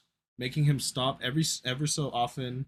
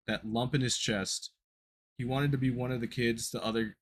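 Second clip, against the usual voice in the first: ordinary delivery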